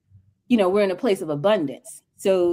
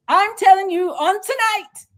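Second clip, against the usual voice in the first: preacher's proclamation; 9 semitones higher than usual. Real, not acted. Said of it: "speaking from the seat of the ancestors".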